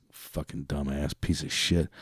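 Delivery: muttered insult. Real, not acted. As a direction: grumble it under your breath.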